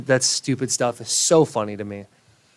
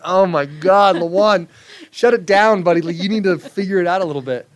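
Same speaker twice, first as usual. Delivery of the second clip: loud, higher mock scolding; friends mocking; 7 semitones higher, 8 dB louder than usual. Buddies telling him to shut it down, loud mocking. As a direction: tease him loudly like a buddy calling him out. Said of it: "I'm like".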